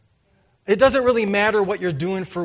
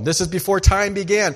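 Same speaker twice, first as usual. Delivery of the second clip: ordinary delivery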